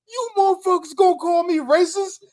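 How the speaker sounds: high, indignant squawk; outraged mock voice. Retelling a live-stream man to friends, high mock outrage. Voicing man in retold video.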